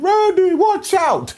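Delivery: high-pitched mock yell of alarm; retold warning shout. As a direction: imitate shouting an urgent warning. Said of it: "Flair had shouted".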